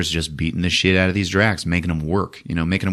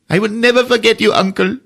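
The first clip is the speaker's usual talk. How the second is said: high, fast, eager promise; earnest kid; 5 dB louder than usual. Retold or imitated. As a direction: blurt it out like a devoted boy.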